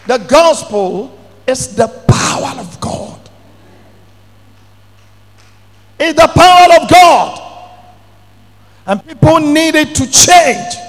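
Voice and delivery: loud shouted sermon lines; fiery preaching. Acted. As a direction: proclaim it to the whole church. Preaching at full force.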